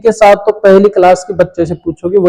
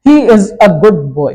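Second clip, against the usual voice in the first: slow dictation; slower than usual. Shown as a demonstration. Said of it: "तो यहाँ पर लग जाएगा प्यारे बच्चों ए का प्रयोग".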